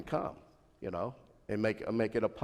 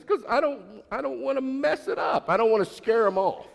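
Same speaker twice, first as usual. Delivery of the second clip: put-on much higher worried girlish voice; daughter's voice; 13 semitones higher, 5 dB louder than usual. Daughter explaining to dad why she won't ask, high anxious voice. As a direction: play the daughter afraid to lose him, pitched way up.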